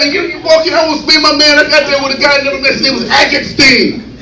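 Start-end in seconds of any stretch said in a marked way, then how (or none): none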